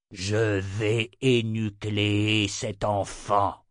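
very slow, deliberate threat; cold announcement, drawn out; menacing emperor. Acted. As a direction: stretch each word out like a cruel tyrant. Annonça Jagang.

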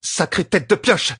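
high, slow exasperated curse. A narrator's reading. Young leader cursing a stubborn ally, exasperated. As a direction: let the curse out. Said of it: "lâcha Egwene".

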